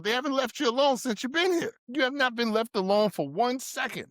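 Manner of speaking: real anger rising as he presses the point; worked-up outburst with the pitch climbing; getting mad. Real, not acted. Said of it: "You can see the veins in your head".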